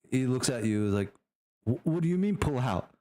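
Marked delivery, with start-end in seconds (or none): 1.6-2.9 bewildered sergeant. Acted. Acts bewildered sergeant questioning the order.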